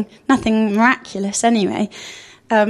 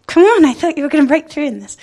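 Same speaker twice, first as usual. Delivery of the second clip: frustrated complaint; 5 semitones higher, 3 dB louder than usual. Retold or imitated. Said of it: "and more like".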